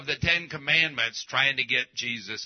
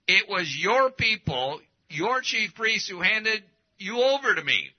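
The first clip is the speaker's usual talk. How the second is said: higher-pitched, insistent accusation; emphatic; 6 semitones higher than usual. Acted. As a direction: press the blame onto them, in their face. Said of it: "because this is very emphatic".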